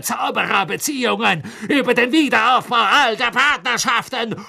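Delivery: fury building to a high shout; raging. A narrator's reading. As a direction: sink to a seethe, then climb into shrieking outrage. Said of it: "offenbar rasend vor Zorn".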